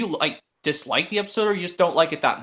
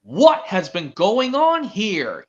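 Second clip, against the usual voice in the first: very slow, louder scolding controller voice; stern boss; 4 semitones higher, 4 dB louder, much slower than usual. Acted. Boy acting out the controller telling off Thomas, slow and stern. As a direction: drag each word out like a cross boss. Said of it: "Like this, where he's like".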